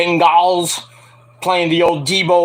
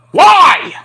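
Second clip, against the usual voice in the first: sudden high shouted why; outraged why; 12 semitones higher, 9 dB louder than usual. Real, not acted. Streamer shouts at wrestling news, disbelieving. Outraged at gimmick being dropped.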